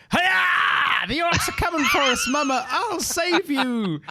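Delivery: put-on high squeaky child shouting gleefully; excited kid voice. Jaska jumping out to scare the party, high excited kid voice. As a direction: play an overexcited little kid charging in. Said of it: "and he goes".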